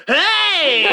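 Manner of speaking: sudden loud high yelp; startled shout. Retold or imitated. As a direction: yell out in surprise. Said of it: "and I went".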